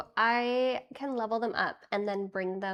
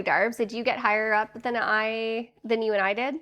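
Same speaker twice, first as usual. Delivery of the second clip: usual speaking voice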